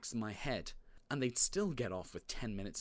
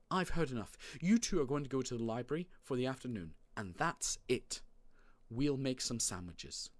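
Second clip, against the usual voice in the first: firm mum laying down the law; exasperated parent, voice slightly raised; fed-up mother; 4 semitones higher than usual. Acted. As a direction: put an end to the argument briskly.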